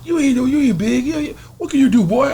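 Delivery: high mocking big-man taunt; Debo taunting.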